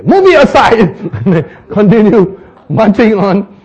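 high, loud command; barked order. Acted. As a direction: snap the order at the troops. Captain ordering soldiers.

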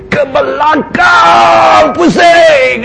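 loud, high, drawn-out drill command; military drill shout. Shown as a demonstration. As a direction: bark it like a commander to his troops. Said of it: "he has to bring his voice and he commands".

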